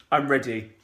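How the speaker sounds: ordinary delivery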